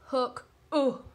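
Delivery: "oo" in hook, said short and bright; short oo sound. Shown as a demonstration. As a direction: clip the "oo" as in hook. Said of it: "Diagraph O O".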